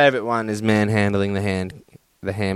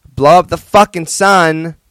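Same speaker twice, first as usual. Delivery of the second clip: rallying cry; 6 semitones higher, 9 dB louder than usual. Real, not acted. Podcast theme rallying cry.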